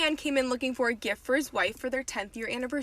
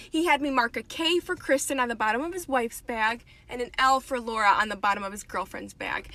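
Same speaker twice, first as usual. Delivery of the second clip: ordinary talk, nothing marked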